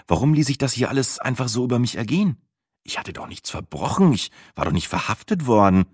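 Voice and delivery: plain reading voice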